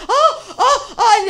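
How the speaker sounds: high, laughing mock moans; mock moaning. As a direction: ham up her moaning with a laugh. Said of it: "and she's like".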